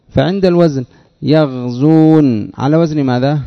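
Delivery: normal talking voice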